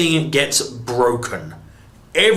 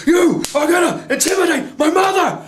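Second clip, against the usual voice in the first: much higher, louder furious shout; mock Superman yell; 3 dB louder than usual. Reviewer acting out Superman tackling Zod, high furious shout. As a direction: roar it as Superman defending his mum.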